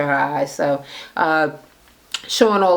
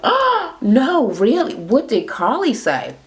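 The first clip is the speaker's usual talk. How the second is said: mock surprise; 8 semitones higher, slower than usual. [name] playing along, gasping.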